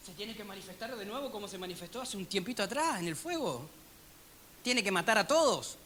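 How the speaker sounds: near-whispered, hushed quote; hushed aside. Acted. Preacher voicing the prophet's demand, hushed. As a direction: drop almost to a whisper.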